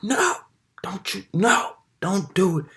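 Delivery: high-pitched imitated pleading; urgent plea. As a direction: act out a friend urgently stopping a punch. Friend holds him back from punching.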